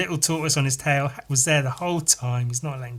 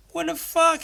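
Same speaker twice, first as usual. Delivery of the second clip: high, incredulous mock outburst; shocked disbelief; 13 semitones higher than usual. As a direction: react aghast, pitch shooting up. Shocked reaction to bitten-off nose.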